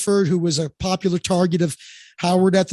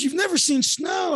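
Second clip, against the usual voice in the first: amazed, pitched up high; high surprised question; surprised assistant; 8 semitones higher, 3 dB quieter than usual. Retold or imitated. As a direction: disbelief at someone who never saw snow.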